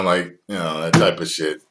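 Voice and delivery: ordinary talk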